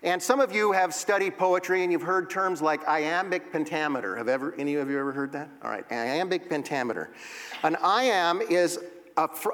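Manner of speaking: ordinary talk, nothing marked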